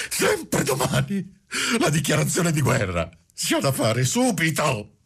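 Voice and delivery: high-pitched madman's fury mixed with despair; angry despair. A narrator's reading. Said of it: "furia tutta mescolata di stizza e di disperazione".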